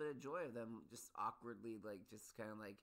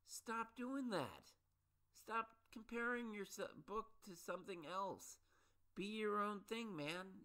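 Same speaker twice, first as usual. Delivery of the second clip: higher, slow, put-on voice pleading with authors; hammed-up frustrated plea, pitched up and drawn out; exasperated mock rant; 10 semitones higher than usual. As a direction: scold the ads in an exaggerated high voice. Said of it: "I'm just like".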